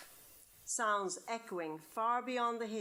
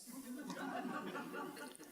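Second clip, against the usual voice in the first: put-on girlish giggle; 6 semitones higher, 8 dB quieter than usual. Nan laughs at the plan.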